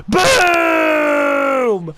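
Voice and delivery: long, high booming cry; excited shout. Real, not acted. Player cheering a hit on Griffith, excited shout. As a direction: draw it out in a loud falling yell. Big hit during live game.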